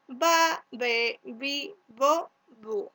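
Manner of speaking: "ba, be, bi, bo, bu." each syllable separate and emphatic; sílabas marcadas. Shown as a demonstration. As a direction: chant the syllables "ba, be, bi, bo, bu." one by one for the class. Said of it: "suena bu". (sílabas "ba, be, bi, bo, bu." bien separadas)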